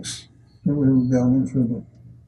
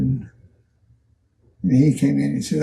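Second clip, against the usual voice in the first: normal talking voice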